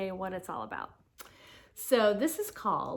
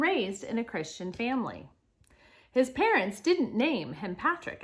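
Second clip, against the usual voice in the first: projected, lilting read-aloud with punched openings; reading to children; 2 semitones higher, 7 dB louder than usual. Acted. Teacher reads Saint Patrick's life story to children, animated read-aloud. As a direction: read clearly to the class, lifting key words.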